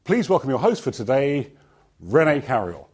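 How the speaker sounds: loud, projected conference announcement; tannoy announcer. Retold or imitated. Introducing the host to a conference audience, loud tannoy announcer. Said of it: "a voice came over the tannoy".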